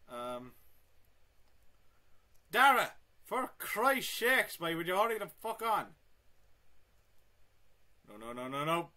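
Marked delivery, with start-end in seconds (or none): 2.5-5.9 exasperated shout. Real, not acted. To a dawdling mate, a loud angry shout. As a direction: bellow at him to hurry. Berating a slow teammate.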